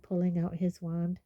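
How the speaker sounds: ordinary delivery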